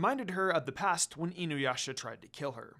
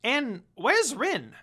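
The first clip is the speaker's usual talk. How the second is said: high, loud, drawn-out question; a hint of creature voice fretting; slow worried Jakin; 7 semitones higher, 7 dB louder, much slower than usual. Jakin fretting about Rin.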